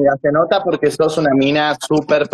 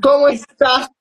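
gushing greeting; 7 semitones higher than usual. Real, not acted. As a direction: fawn over her with pet names. Affectionate over-the-top greeting.

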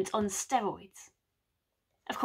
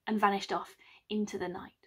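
whisper; 3 semitones lower, 7 dB quieter than usual. A narrator's reading. Sneaking off.

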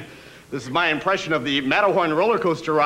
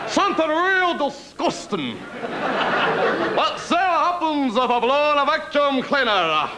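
inventor voice; 8 semitones higher than usual. Retold or imitated. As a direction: play the scheming inventor, pitched up. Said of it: "The guy had to say to himself".

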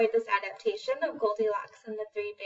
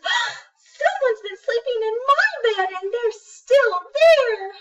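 high, loud, squeaky little cub; Baby Bear; 13 semitones higher, 9 dB louder, slower than usual. Acted. Baby bear spots Goldilocks still in his bed, high alarmed squeal. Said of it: "And Baby Bear says".

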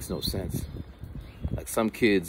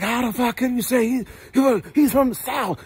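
much higher, incredulous; barbershop guy; 5 dB louder than usual. Retold or imitated. Barber arguing about best MC, high incredulous voice. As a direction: scoff like a barbershop regular.

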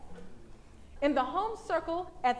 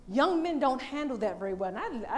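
usual speaking voice

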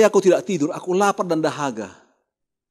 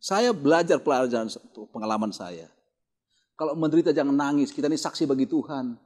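ordinary talk, nothing marked

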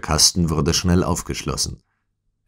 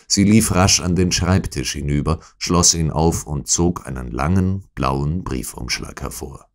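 ordinary delivery

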